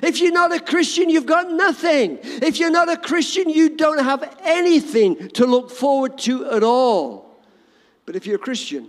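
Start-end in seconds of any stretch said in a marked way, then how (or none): 0.0-7.0 loud, high fiery preaching; preaching at full force. Acted. Pastor warning the congregation, loud fiery preaching. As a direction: thunder it out to the congregation.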